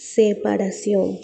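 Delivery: "Separación" said slowly and deliberately; slow emphasis. Shown as a demonstration. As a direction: lean on "Separación", drawing it out. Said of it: "Está en rojo". ("Separación" lento y marcado)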